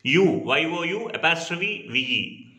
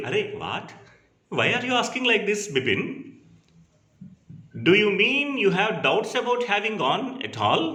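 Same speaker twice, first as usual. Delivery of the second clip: a mild surprised "Arey, what?" rising higher; surprised, worried; 5 semitones higher than usual. Acted. Chuni startled by Bipin's question, mildly alarmed. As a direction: sound puzzled and a little worried. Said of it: "he was surprised and there was some worry".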